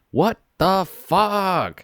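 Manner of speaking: high, drawn-out baffled exclamation; baffled outburst. Retold or imitated. Saying it aloud to nobody while reading, baffled. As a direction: stretch out each word in rising disbelief. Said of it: "I was baffled".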